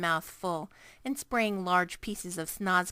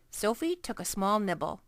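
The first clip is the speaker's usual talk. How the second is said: usual speaking voice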